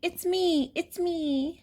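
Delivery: high, excited little pig voice; pig character. A narrator's reading. Pig revealing who is under the mask, high-pitched. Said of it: "says the pig".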